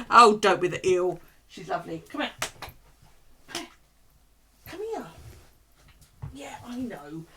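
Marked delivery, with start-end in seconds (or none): 0.1-1.2 louder, laughing mock dog line; voicing the dog. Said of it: "She just goes". 2.1-7.2 much higher, soft coaxing; coaxing the dog. Real, not acted.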